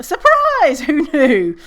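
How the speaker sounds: very high, loud mock "Surprise!"; sarcastic surprise. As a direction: shriek it like a fake party reveal. Sarcastic reveal about racism.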